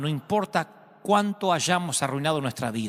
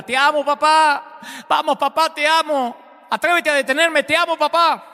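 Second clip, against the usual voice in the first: circle him and dare him to stop you; provoking taunt; 11 semitones higher, 6 dB louder than usual. Retold or imitated. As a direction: son taunts his fleeing father with love, loud and defiant. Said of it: "lo provoca".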